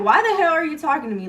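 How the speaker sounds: mock-confront them, quick and pushy; retold telling-off. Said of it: "I literally was going off on them".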